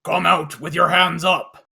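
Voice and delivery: loud mechanized robot command. Acted. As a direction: bark it like a machine guard. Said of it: "loud robot mechanized voice say".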